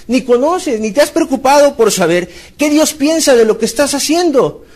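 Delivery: fiery sermon climax; impassioned preaching. Acted. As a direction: press the congregation hard. Preaching at full force.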